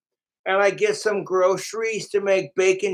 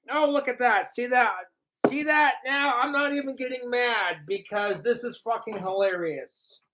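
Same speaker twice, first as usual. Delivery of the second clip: normal talking voice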